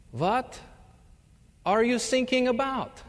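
put-on lightly done German accent, earnestly asking. German recruit mishears sinking as thinking. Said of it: "in his thick German accent".

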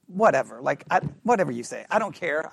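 low, offhand indifference; dismissive. Retold or imitated. Son shrugging off his parent, dismissive. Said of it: "He's like".

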